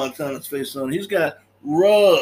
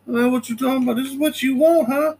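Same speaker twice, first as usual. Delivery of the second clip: higher, taunting voice of Tony's armed sister; sister with a gun; 10 semitones higher, faster than usual. Retold or imitated.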